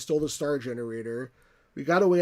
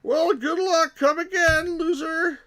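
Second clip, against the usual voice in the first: high, loud Texan salesman closing a sale; hammed-up Texan dealer, loud and high; Texan accent; 8 dB louder, slower than usual. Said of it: "like, a Texan accent".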